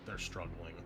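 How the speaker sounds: usual speaking voice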